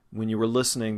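usual speaking voice

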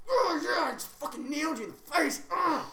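loud, high gloating gamer yell; gleeful principal gamer. Pretend. Principal at home gloating over a headshot, loud high crowing. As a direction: crow it like he just got a kill. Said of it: "He's like".

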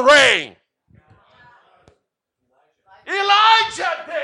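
builds from loud question to a high, loud shout; rising preacher shout. Real, not acted. Preacher shouting to the congregation about who initiated rain.

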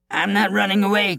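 squealing coward; frightened cry. Acted. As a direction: cry out in fear. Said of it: "cried the coward".

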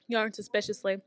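ordinary delivery

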